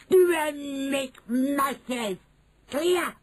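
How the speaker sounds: high slow witch commands; commanding witch. Acted. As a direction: lay down orders as the witch. Witch gives orders.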